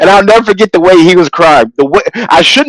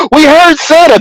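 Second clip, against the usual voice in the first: put-on excited kids; 11 semitones higher than usual. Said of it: "And the kids like".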